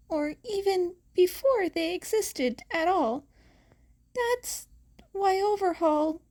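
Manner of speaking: a hint of a small frightened girl; young girl. A narrator's reading. Eri explaining her quirk to Aizawa, a touch tearful. Teen Eri voiced higher.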